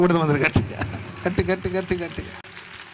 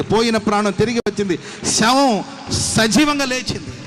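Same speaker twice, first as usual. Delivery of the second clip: preaching at full force; 3 semitones higher, 5 dB louder than usual. Acted. Preaching climax to congregation.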